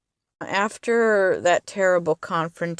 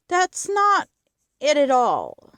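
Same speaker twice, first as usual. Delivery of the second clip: passionate outburst; 8 semitones higher, 4 dB louder than usual. Real, not acted. Speaker passionately rejects interpretation.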